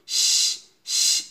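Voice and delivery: শ as a long voiceless hiss. Shown as a demonstration. Teacher models the sound of shin, hissing শ. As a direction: let only air out on a sustained শ. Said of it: "এভাবে শব্দ করেন".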